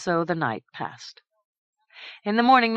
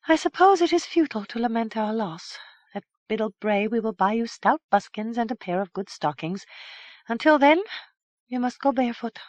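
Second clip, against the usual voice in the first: put-on young woman voice; 7 semitones higher than usual. Madook resigned.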